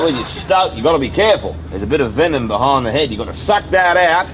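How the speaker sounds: put-on lightly done Australian accent. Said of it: "My name's Brian Logan, from Australia".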